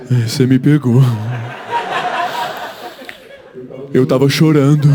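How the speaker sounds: lightly voiced stoned brother. Brother caught red-eyed, sheepish low confession. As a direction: play the caught stoner owning up.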